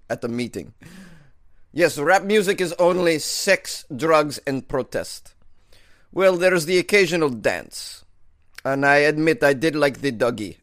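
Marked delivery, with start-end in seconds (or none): 1.7-10.6 slow, loud mock Russian accent. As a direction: play a slow hammy Russian leader. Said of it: "continue my Russian accent".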